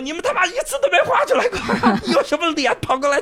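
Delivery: very high, laughing jeer; mocking through laughter. Onlooker jeering at the pilots in the bar, laughing scorn. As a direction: scold them while cracking up.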